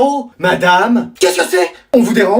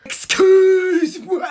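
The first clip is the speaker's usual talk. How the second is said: drawn-out high shocked "Excuse-moi"; long, high cry of indignation; outraged excuse me; 9 semitones higher, much slower than usual. Acted. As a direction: stretch it out in disbelief, way up high. Parent shocked at son's excuse.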